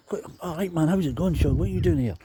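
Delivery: acting out a bandmate's surprised welcome; surprised greeting. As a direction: greet an unexpected mate, pitch lifted. Surprised band greets the unexpected stand-in.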